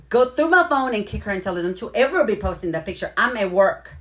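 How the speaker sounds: loud, high playful telling-off; mock scolding. Host jokingly scolds viewer for posting pictures, loud mock scolding. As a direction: pretend to scold a friend over her photo.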